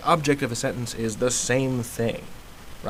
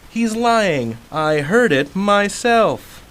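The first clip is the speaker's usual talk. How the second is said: higher and louder, slow emphatic I heard it myself; excited outrage; 7 semitones higher, 6 dB louder, much slower than usual. Shown as a demonstration. Teacher acting out catching a liar, worked up and loud. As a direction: insist someone is lying, dragging out myself. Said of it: "getting really excited as I say the following".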